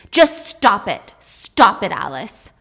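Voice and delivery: loud, slow shouted order; scream. Acted. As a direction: shout her down. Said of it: "he screams".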